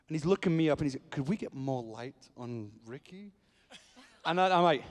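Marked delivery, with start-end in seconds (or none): 1.1-3.3 slow, softened aside; imitating a tactful murmur; discreet retold whisper. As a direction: quietly ask as if into someone's ear. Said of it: "he's having a whisper in his ear".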